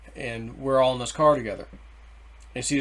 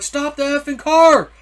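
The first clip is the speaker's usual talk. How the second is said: loud, high, drawn-out yell; furious re-enacted shout; getting mad; 11 semitones higher, 9 dB louder than usual. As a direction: holler it at the driver, stretching the words. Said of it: "I'm getting mad".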